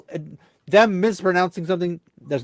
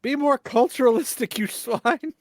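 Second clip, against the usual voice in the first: mock snooty insult; 8 semitones higher than usual.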